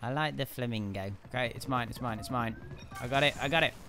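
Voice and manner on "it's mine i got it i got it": ordinary talk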